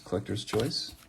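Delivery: ordinary talk, nothing marked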